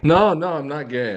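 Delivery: high, loud protest that quickly drops; urgent denial. Real, not acted. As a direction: protest in a hurry, starting high. Protesting after being teased about sexuality.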